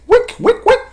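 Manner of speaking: sudden very high playful squeak; high squeaky repeat. Pretend. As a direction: squeak the word up high, teasing. Playful repeat of chart term.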